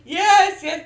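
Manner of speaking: loud, high excited yes. Real, not acted. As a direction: cry out yes with glee. Excitedly confirms yodeling.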